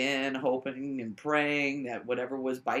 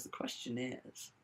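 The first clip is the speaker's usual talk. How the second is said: hushed question; 11 dB quieter, slower than usual. Retold or imitated. Jim's creepy question, retold.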